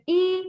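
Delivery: E held high and level; stretched first tone. Shown as a demonstration. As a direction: stretch E out on a high, steady pitch. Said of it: "So you stretch it".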